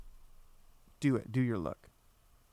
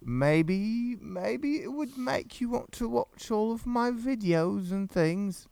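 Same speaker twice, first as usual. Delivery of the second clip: higher, slow put-on voice; higher slowed silly voice; 6 semitones higher than usual. Shown as a demonstration. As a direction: ham up a silly voice to tempt her. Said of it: "I talk like this?".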